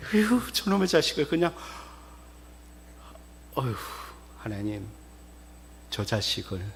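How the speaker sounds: sigh, then a low, soft plea; exasperated praying parent. Acted. Parent praying about a troublesome child, sighing and hushed. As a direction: pray it wearily under your breath. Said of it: "그게 부모가 자식을 보면서".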